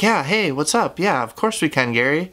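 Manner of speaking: high-pitched put-on cheerfulness; fake happy. Agreeing to talk with the boss, fake happy. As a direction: chirp it, pretending all is fine. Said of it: "pretending to be happy".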